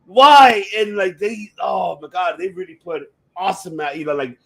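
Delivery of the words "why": loud stunned outburst; shocked what the fuck. Retold or imitated. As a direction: blurt it out in disbelief. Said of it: "I was like".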